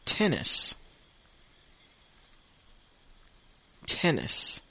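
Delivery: tennis said the English way, TEN-nis; tennis, English vowels, teacher model; English pronunciation. Shown as a demonstration. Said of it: "deux fois en anglais".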